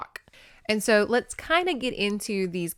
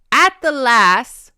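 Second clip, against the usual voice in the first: slow, raised Scottish exclamation; loud clansman; 5 semitones higher, 12 dB louder, slower than usual. A narrator's reading. Ross answering Neil's prompt, loud Scots. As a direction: call it out slowly and loudly. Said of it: "Ross said".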